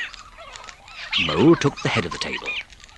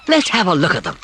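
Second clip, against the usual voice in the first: louder eager call; Rat cries out; 8 semitones higher, 5 dB louder than usual. A narrator's reading. Rat to Mole, heading for the door eagerly. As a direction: jump up keen to see. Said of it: "cried the Rat".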